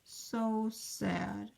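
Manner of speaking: sad kangaroo. Acted. Rejected kangaroo hopping off, soft and sad. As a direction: sigh it out, the kangaroo lost. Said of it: "the kangaroo sadly says".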